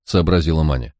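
ordinary delivery

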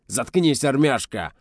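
high, loud, furious roar. A narrator's reading. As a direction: roar at the neighbour to shut up. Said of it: "взревел его краснолицый сосед".

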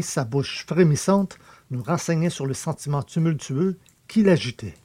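ordinary delivery, nothing marked

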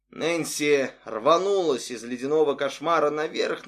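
ordinary delivery, nothing marked

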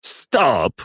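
high, sharp stop order; commanding shout; barked command. A narrator's reading. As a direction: cut the rant off with one word. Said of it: "рявкнул Фламель".

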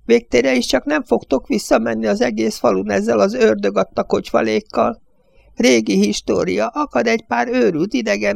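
put-on high, bleating old man's voice; whiny, quavering old judge pitched high.

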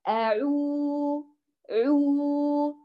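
أعو very slow with lips round but relaxed; correct round ʿu. Shown as a demonstration. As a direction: model أعو slowly, rounded without clenching. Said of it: "سمعتوا كيف؟".

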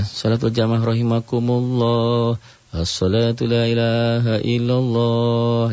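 slow sung call; gentle prayer call. Shown as a demonstration. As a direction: chant it calmly and politely. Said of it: "yang pelan, yang sopan".

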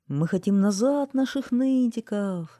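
loud, high, drawn-out demand; crowd shouting. A narrator's reading. Townspeople demanding their whiners back, shouting. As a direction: chant it out like an angry crowd. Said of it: "закричала однажды вся толпа".

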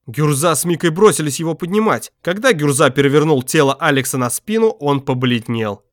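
plain reading voice

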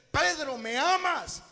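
loud, high, slow "Pedro, ¿me amas?"; solemn loud calling. Acted. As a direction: call out to Peter, drawn out. Jesus asking Peter. (Jesús llamando a Pedro fuerte y lento)

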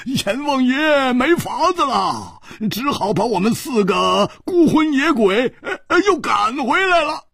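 high laughing joker's voice, bursting with glee; hearty laughing. A narrator's reading. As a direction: laugh the lines out, high and teasing. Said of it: "胡铁花大笑".

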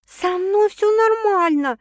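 high, drawn-out whining protest; loud lamenting woman; wailing. A narrator's reading. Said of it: "запричитала Саша".

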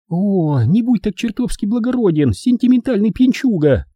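high falsetto scolding; high-pitched, contemptuous attack; lashing out. A narrator's reading. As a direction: berate him in a high woman's voice. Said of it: "набросилась на Бобби Эдвина".

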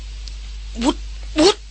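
urgent breathless call; out-of-breath excited woman. A narrator's reading. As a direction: call out to him in a fluster. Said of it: "เสียงของหล่อนตื่นเต้น เร่าร้องกระหืดกระหอบ".

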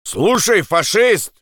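high-pitched challenging call; hostile hail. Acted. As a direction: hail an enemy from afar. Guard hails him from above. (враждебный окрик)

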